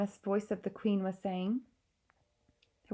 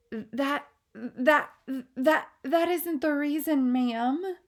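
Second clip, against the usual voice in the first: higher, stammering frightened words; a maid too scared to speak; quivering maid; 7 semitones higher, 6 dB louder, slower than usual. Acted. Maid stammering to the queen, quivering fear. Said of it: "The maid's voice was quivering terribly".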